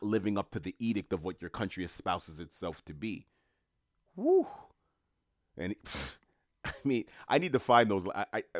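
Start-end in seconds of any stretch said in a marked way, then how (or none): 4.2-4.5 very high, loud whoop of amazement; delighted high-pitched woo, loud; excited woo. Real, not acted. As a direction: let out a high whoop of awe. Reacting to Marx quote.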